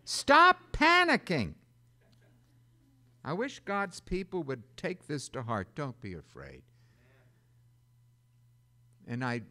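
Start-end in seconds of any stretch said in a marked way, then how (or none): 0.1-1.5 high, loud, slow Stop panicking; shouted command. Shown as a demonstration. Preacher shouting at his congregation, high and loud. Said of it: "sermon refrain". 5.8-6.6 low, soft Don't be afraid; soft reassurance. Shown as a demonstration. Preacher comforting his flock, low and soft. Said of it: "sermon refrain".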